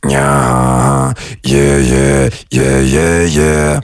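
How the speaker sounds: deep, distorted throat bass with some nose; low pulsing buzz from throat and nose. Retold or imitated. Coach showing how to get the sound, nasal throat bass. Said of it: "you kinda will get the sound of [name]".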